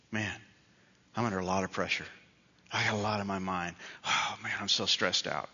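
low, soft, put-upon whine; mock world-weary complaining, quietly; stressed sighing whine. Shown as a demonstration. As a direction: sigh it out as if under pressure. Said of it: "it's a way of whining".

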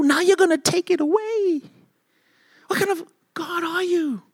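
high, indignant, petulant complaint; whiny protest. Pretend. Believer complaining to God about the rake, high and whiny. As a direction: whine like a child losing a toy. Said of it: "And you go".

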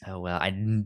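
ordinary talk, nothing marked

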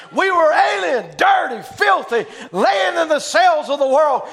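loud, high, slow preacher's cry; rising revival preaching; preaching at full force. Acted. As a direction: proclaim it to the congregation.

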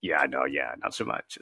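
breathy whisper, no voice; a real quiet whispered aside. Real, not acted. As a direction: drop to a whisper. Quiet aside after laughter.

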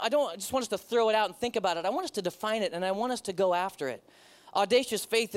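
usual speaking voice

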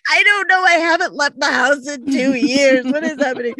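high, loud, frantic excuse; flustered, rushing the excuse; retold panic. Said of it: "I'm like".

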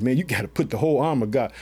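low, quick offhand restating; casual paraphrase. Real, not acted. Preacher restating the verse to the church, low and casual. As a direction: toss it off like street talk.

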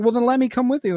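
put-on high-pitched pleading kid; eager child asking to tag along; Omega. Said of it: "She's like".

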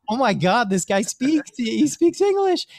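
high-pitched, acted-out amazement; astonished disbelief. Stranded student realizes the mystic speaks English, astonished outburst.

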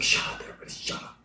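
low whispery mock "shut up"; retold muttered plea; exasperated hush. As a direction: plead for silence under your breath. Retelling a hushed plea amid yelling.

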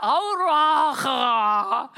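loud, very slow stretched au revoir; chorus farewell. Retold or imitated. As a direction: drag out au revoir all together. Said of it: "alle bare". (høyt og utdratt au revoir)

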